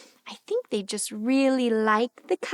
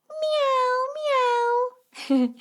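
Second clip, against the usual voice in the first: cat meowing; 9 semitones higher than usual. Acted. Imitating cat.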